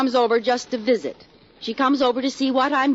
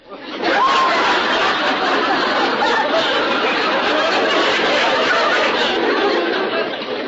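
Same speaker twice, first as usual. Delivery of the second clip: long high giggling fit; a high squealing laugh that rolls on; big laugh; 4 dB louder than usual. Acted. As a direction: burst into helpless giggles. Laughs at own mistake.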